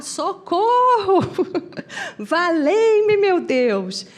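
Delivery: high, loud, drawn-out wail; hammed-up scream for help breaking into a laugh; mock desperate shout. Chico insists on screaming for help, loud mock wail. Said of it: "Então eu vou gritar".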